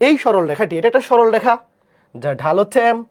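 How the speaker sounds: ordinary talk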